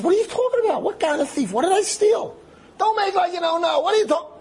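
very high, agitated, bewildered denial; indignant protest. Retold or imitated. Accused cyclist answering the screaming mob leader, indignant. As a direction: protest loudly at a high pitch.

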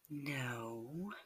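very soft, teasing no with a smile; smiling refusal. A narrator's reading. Partner turning down lover's plea, smiling and hushed. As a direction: refuse gently through a smile. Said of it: "you say with a smile".

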